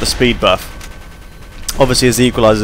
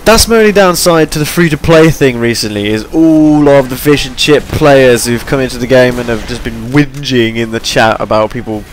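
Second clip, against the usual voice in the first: usual speaking voice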